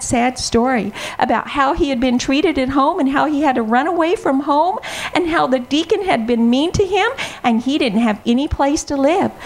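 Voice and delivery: ordinary delivery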